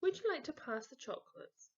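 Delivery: lightly voiced cheerful mum. Mum asking Peter to pass chocolates, lightly voiced.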